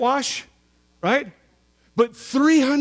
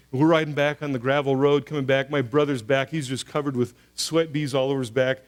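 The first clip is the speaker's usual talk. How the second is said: ordinary talk, nothing marked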